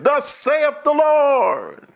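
high, drawn-out prophet's cry; slow, soaring prophetic declaration; prophetic proclamation. Acted. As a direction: proclaim it like an Old Testament prophet, stretched out high. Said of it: "people says".

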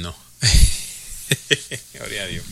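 usual speaking voice

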